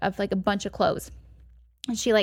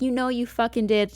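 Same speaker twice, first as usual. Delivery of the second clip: ordinary talk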